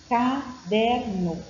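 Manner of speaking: "Caderno" very slowly, split into its syllables. Shown as a demonstration. Teacher showing a child's syllabic reading, "Caderno" syllable by syllable. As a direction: pause between the syllables of "Caderno". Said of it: "cada sílaba, uma letra".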